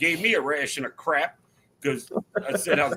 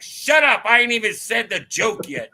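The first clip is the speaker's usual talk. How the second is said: higher, louder comic snap at the audience; mock sassy snap; 5 semitones higher, 4 dB louder than usual. Miss June scolding an early laugher in the crowd, mock snapping. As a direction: playfully bark it at a laugher. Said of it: "she's like".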